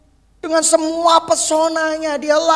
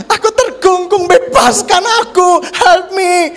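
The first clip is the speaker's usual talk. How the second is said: hammed-up wail, higher and louder; mock plea; 7 semitones higher, 6 dB louder than usual. Preacher mocking wife who calls to be freed, mock wail. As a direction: beg to be freed in mock despair. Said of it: "teriak-teriak".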